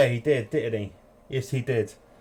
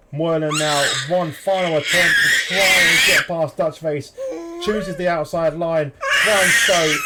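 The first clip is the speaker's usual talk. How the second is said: higher, louder, excited race call; live play-by-play; 6 semitones higher, 5 dB louder than usual. Acted. Commentator calling the final lap live, excited and loud.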